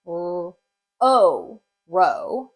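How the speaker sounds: r- alone, a loud long o, then "row"; sounding out. Shown as a demonstration. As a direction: build row from r- and o, with pauses. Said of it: "next syllable says".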